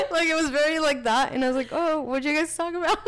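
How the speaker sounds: high, laughing through the awkward words; nervous laughter. Retold or imitated. Reliving the awkward call with grandma, laughing nervously. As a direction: giggle helplessly through the line.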